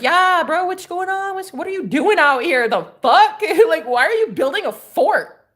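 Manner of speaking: high-pitched incredulous questioning; acted bro-to-bro disbelief; amused bafflement. Acted greeting of supposed roommate.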